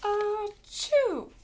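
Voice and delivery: big, high, loud "achoo"; acted sneeze. A narrator's reading. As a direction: sneeze loudly with a drawn build-up. Sneeze after don't sneeze warning.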